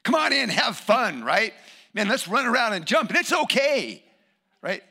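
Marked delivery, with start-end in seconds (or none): 0.0-1.2 loud, high, lively invitation; boisterous welcome. Acted. Acting the opposite, welcoming kids loudly. 1.9-4.0 loud, high, rowdy cheer; exuberant, raised voice; boisterous encouragement. Acted. Acting permissive, playful church folk.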